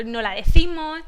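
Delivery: "decimos" said as "decimo", final S left off; dropped final S. Shown as a demonstration. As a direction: swallow the S of "decimos", Andalusian style. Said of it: "la S final no la". ("decimos" sin la S final, como "decimo")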